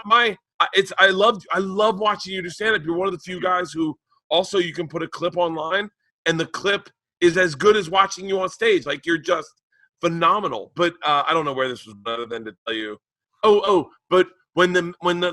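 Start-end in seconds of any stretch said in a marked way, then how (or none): none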